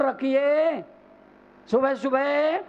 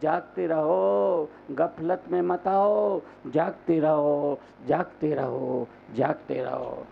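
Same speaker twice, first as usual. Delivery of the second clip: low, slow call dying away; watchman's call; 6 semitones lower than usual. Acted. Monk calling listeners not to be careless, fading watchman's call.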